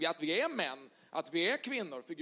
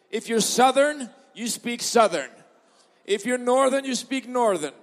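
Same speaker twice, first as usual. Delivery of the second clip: playful louder English call; preaching call; 3 semitones higher, 6 dB louder than usual. Acted. Pastor teasing the crowd about dialects, playful call. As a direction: sing it out to the room. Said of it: "preacher playful English call".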